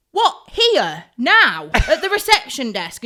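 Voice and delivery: loud, punched one-word questions; incredulous shock. Retold or imitated. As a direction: blurt each question in mock horror. Retelling her shock at undressing.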